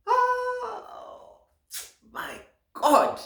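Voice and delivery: high, loud cry of admiration; swooning exclamation. Acted. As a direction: swoon loudly, voice leaping way up. Swooning over women's cleavage.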